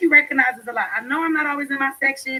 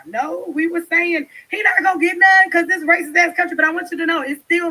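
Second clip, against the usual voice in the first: loud, higher, fired-up protest; heated shout; 5 semitones higher, 6 dB louder than usual. Real, not acted. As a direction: push back hard and loud. Heated defence of earlier claim.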